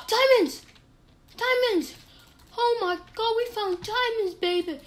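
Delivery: high gasping excited shout. Real, not acted. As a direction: burst out with joy. Finds diamonds.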